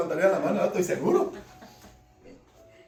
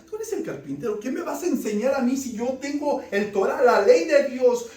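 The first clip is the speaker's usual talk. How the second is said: scornful challenge; 5 semitones higher, 7 dB louder than usual. Acted. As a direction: sneer down at the carpenter's son. Critics scorn Jesus the carpenter.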